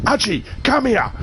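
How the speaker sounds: hammed-up furious chef calling someone over; Gordon Ramsay impression. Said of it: "switched over to Gordon Ramsay".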